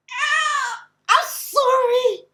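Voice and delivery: loud pained wail, drawn out; cringing cry. Real, not acted. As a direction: cry out in secondhand embarrassment. Cringe reaction at scene.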